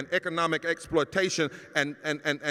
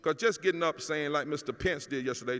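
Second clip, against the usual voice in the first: ordinary talk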